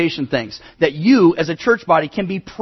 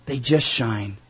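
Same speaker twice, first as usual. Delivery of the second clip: much lower and softer; quiet echo; 7 semitones lower, 6 dB quieter, slower than usual. Acted. Preacher repeating the lighthouse line to his congregation, hushed and low. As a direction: drop it to a soft, low echo.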